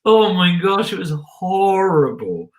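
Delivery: high, dragged-out dismay; mock horror. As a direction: recall a disaster with theatrical horror. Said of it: "I was just like".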